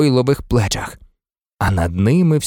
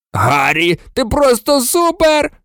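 high, excited holler; roared praise; 8 semitones higher than usual. A narrator's reading. Seamus Finnigan congratulating Harry, roaring. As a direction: build from the name into a high cheer. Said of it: "ревів Шеймус Фінікан".

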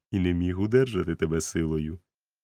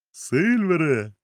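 slow, higher, louder call; 9 semitones higher, 6 dB louder, much slower than usual. A narrator's reading. Doctor shouts for Silver, drawn out and loud. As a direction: call the name across the clearing. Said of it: "крикнув він".

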